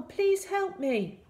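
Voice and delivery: high, drawn-out plea; calling for help. A narrator's reading. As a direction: call out for help, high and slow. Stranded boy calls for help.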